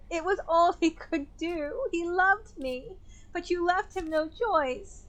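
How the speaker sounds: high, pressed voice of a bitter widow; accusing widow Clarissa. Acted.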